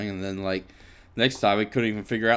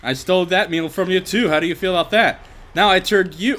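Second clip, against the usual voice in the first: taunting; 7 semitones higher, 6 dB louder than usual. Pretend. Taunting dinosaur loudly.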